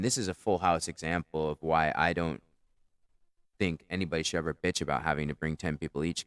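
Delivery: ordinary talk, nothing marked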